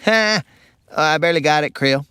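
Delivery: ordinary talk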